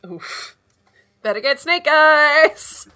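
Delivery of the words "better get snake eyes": loud, slow dice taunt; loud hype. Real, not acted. Hyping the dice roll.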